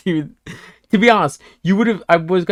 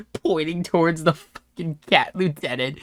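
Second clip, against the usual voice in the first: ordinary delivery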